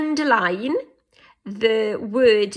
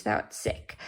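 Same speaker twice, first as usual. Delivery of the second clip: hushed reading; 10 semitones lower, 8 dB quieter than usual. Shown as a demonstration. Reading a poem quote.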